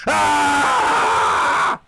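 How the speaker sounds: short hammed-up shriek of pain; mock scream. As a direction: yelp like a kid whose skin burns. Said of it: "Your kids are gonna come in".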